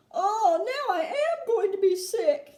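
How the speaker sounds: slow, whiny half-laughing moan; a nauseous lady giving up; mock wail of a queasy woman; sick wail. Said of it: "yelled Missus T V".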